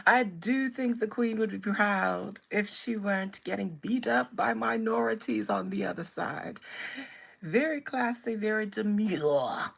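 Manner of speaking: loud, hammed-up British pronouncement; theatrical put-on British accent; mock British accent. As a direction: hold forth like a caricature Brit. Continues British act, invokes the Queen.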